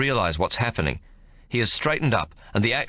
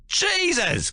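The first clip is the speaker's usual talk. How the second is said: high, sudden cry of terror; shocked yell. Acted. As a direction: gasp it out as the axe just misses you. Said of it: "Cooligan yells".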